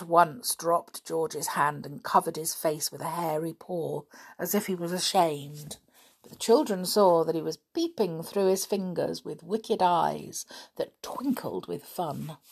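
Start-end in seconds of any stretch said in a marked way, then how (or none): none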